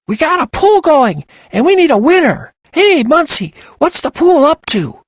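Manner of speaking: very high, loud squeaky screech; helium screech. Acted. Penny insisting Desiree answer, high squeaky screech.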